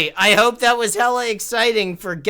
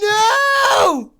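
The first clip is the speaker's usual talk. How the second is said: anguished yell; 6 dB louder than usual. Real, not acted. Losing the game.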